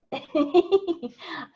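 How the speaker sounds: a bright embarrassed giggle, rising; giggling. Real, not acted. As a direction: giggle helplessly at the nodding. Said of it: "sorry, I'm giggling".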